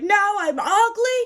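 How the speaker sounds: high, loud mock wail; hammed-up crying in disbelief; retold tearful outburst. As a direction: cry it out, high and loud, imitating a teenage meltdown. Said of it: "I burst into tears".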